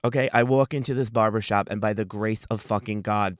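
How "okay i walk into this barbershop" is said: usual speaking voice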